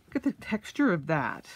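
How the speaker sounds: ordinary delivery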